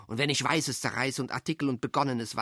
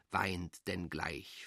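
low sorrowful murmur; 8 semitones lower, 8 dB quieter, much slower than usual. Acted. A lonely voice murmuring low to itself. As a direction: sink low and soft, slowing right down. Dark murmured echo of crying.

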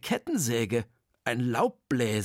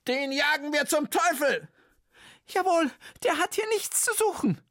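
indignant teachers; 13 semitones higher than usual. Acted. Teachers' angry resolve.

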